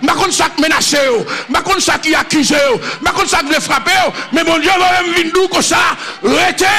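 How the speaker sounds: loud, high-pitched preacher's call; full-force sermon shouting; fiery preaching. Acted. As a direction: preach it out to the whole congregation.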